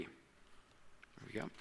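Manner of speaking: whispered, slow, relieved; whispered relief. Real, not acted. Speaker whispers to himself as the slide finally advances, relieved. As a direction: breathe it out under your breath.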